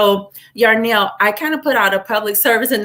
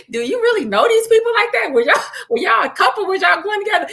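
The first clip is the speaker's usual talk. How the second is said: much higher, fast, half-shouted nosy questions; mock nosy questioning; 11 semitones higher, faster than usual. Teasing Yarnell about a stranger's funeral, high mock grilling. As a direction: needle him like a curious busybody. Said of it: "You like".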